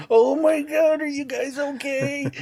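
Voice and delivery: high, breathless mock panic; a clueless hero squeaking out fake concern; MacGruber impression. MacGruber checking on the men he blew up, in mock high panic. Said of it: "was like".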